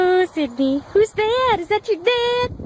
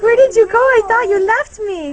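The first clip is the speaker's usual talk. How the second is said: voicing the dog; 3 semitones higher, 8 dB louder, faster than usual. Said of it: "He's like".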